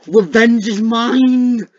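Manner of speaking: high, drawn-out battle cry; vengeful shout. Pretend. Player vowing revenge on a game foe, vengeful shout.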